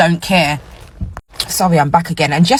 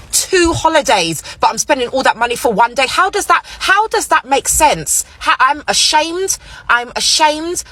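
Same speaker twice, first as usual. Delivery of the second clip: exasperated disbelief; 6 semitones higher than usual. Real, not acted. Ranting about wasted Christmas money.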